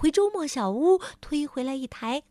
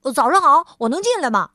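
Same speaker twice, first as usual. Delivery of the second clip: much louder, bright and hurried; brisk busy voice; 3 semitones higher, 8 dB louder, faster than usual. Acted. Mr. Busy at the door, loud and brisk. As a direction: bustle in eager. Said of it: "忙碌先生说".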